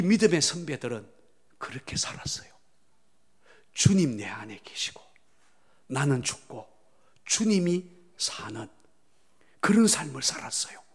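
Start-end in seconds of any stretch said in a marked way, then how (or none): 1.6-10.8 reverent hushed whisper. Real, not acted. Pastor speaks of the faithful forebears, reverent whisper.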